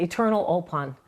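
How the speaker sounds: usual speaking voice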